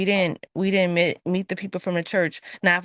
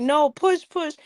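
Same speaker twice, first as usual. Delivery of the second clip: urging push; 6 semitones higher, 4 dB louder, slower than usual. Retold or imitated. Nurses tell her to push.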